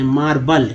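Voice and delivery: मार्बल with a neutral second vowel, "maar-bul"; low-pitched मार्बल, "maar-bul"; relaxed schwa ending on मार्बल; correct reading. Shown as a demonstration. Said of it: "क्या पढ़ेंगे?".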